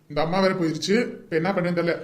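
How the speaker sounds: ordinary talk, nothing marked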